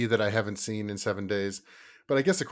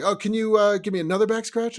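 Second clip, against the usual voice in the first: put-on whiny kid voice; 12 semitones higher, 8 dB louder than usual. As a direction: beg like a sleepy kid stalling bedtime. Kid asking for more.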